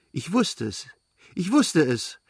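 bursting out with his favourite phrase; blurted out. A narrator's reading. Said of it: "stieß er seinen Lieblingssatz hervor".

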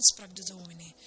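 ordinary delivery, nothing marked

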